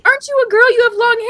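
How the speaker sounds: high-pitched mock child voice; kids quoted. Imitating kids challenging the fake Michelangelo, high child voice. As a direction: pipe up like doubting little kids. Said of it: "They're like".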